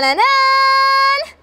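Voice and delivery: a playful ta-da climb; mock fanfare. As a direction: land the suspense tune high and bright. Building suspense before opening case.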